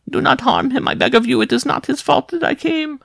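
urgent high falsetto pleading; imploring. A narrator's reading. Wife pleads the rider is blameless, high imploring. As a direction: beg desperately in a very high voice. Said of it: "Jean-Marie implored".

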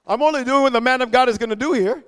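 ordinary delivery

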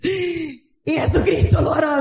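high, shouted sermon climax, slowed on key phrases; fiery preaching. Real, not acted. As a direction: preach it out like a revival call. Preaching at full force. (predicación encendida a gritos)

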